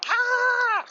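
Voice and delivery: sudden high yelp of surprise; startled shout. Real, not acted. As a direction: jolt out a shocked cry. Startled mid-sentence at a car.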